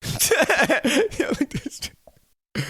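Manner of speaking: drawn out through laughter, slow and high; laughing. Real, not acted. Laughing mid-repeat.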